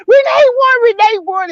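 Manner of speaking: high, loud, teary yelling of yes; yes, yes, yes, she won, yelled high and loud; excited shout. Retold or imitated. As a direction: scream the good news with joy. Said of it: "I was so excited".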